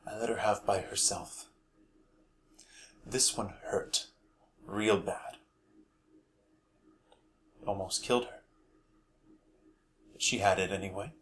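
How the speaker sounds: subdued, softly spoken family confession; Dodge. Acted. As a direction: recall a painful memory softly. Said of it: "I'll be performing the role of Dodge".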